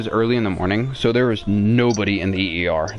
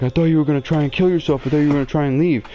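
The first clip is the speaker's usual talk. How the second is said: higher-pitched, quick imitation of dad; raised voice pitch, mock-defensive; retold father's excuse; 6 semitones higher, faster than usual.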